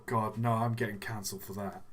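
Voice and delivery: ordinary delivery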